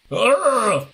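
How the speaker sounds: high, loud mock wail; sudden hammed-up cry of "ugh"; mock scream. Imagines walking out to scare someone.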